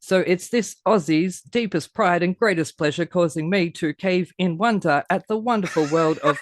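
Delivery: game-show announcer hyping the guest; hype intro. Acted. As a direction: roll out the big rhyming intro like a ringmaster. Host's rhyming hype intro.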